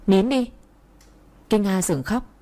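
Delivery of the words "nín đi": a short sharp order, slightly higher; mild snap. Acted. Narrator loses patience with her sobbing friend, a mild snap. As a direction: cut her crying off, mildly irritated. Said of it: "mà quát lên".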